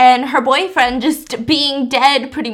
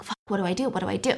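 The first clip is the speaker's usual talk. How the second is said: panicked undertone; 3 semitones lower, 7 dB quieter, much faster than usual. Retold or imitated. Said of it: "And he's like".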